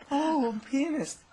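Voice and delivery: high, slow embarrassed squeak; flustered squeak. Retold or imitated. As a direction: squeak it out, caught off guard. Flustered reply to the mother.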